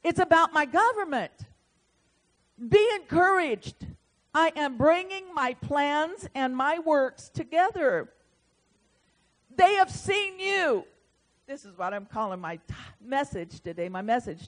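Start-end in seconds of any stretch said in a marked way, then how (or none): 0.0-10.9 loud, high, proclaiming the Lord's word; prophetic declaration. Retold or imitated. Preacher delivering the Lord's message, proclaiming loudly. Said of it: "And it began to flow".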